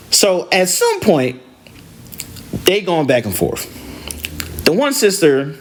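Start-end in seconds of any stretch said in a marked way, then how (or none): none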